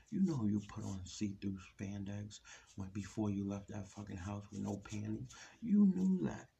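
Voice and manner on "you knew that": higher, louder accusing yell; angry shout. Real, not acted. Scolding women who dress to be seen, shouting. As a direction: throw the accusation hard. Ranting at the woman.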